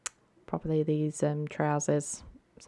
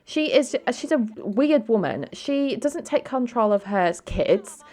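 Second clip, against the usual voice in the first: higher, louder scornful rant; disapproving sister tearing into her sibling's girlfriend, scornful and raised; snobby dismissive sister; 8 semitones higher, 4 dB louder than usual. Acted. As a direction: sneer down at the poor girlfriend, pitched up. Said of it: "And Dina's like".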